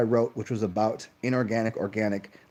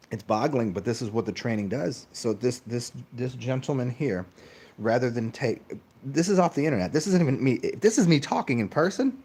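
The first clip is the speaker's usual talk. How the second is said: ordinary talk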